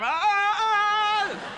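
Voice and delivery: high loud mock screams; blinded cries. Birthday kids blinded by camera lights, loud high yelps.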